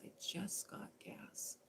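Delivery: breathless whispered aside. Retold or imitated. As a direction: whisper it in dread. Fearful aside.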